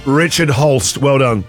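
ordinary talk

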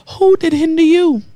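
"Who" launched high and long, falling through "hinder you"; drawn-out WHO. Shown as a demonstration. Preacher challenges listeners to find their hindrance, emphatic high WHO. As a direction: hit "Who" high and hold it, then let the question fall. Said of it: "You have to say who?".

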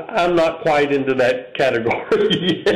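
breaking into a chuckle on the last word; real amused laugh creeping in; laughing while talking. Real, not acted. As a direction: say it through a laugh you can't hold back. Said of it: "can't even say that without laughing".

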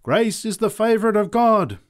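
high, loud sermon cry; preacher's proclamation. A narrator's reading. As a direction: proclaim it to the whole crowd. Preacher calls to the crowd.

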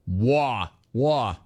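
drawn-out New York vowel, dropped R; mock New York accent. Host echoes the caller's sign-off, mock New York accent. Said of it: "are you from New York".

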